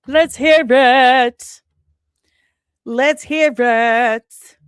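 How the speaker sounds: loud, slow, drawn-out announcer voice; hype announcer call. Acted. Streamer rallying the chat for questions, booming announcer call. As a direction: boom it out like a game-show host inviting the crowd.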